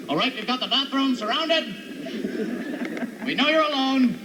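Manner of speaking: high, commanding mock police call; mock cop megaphone. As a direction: order the suspect out like a cop. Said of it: "some weird cop outside your house".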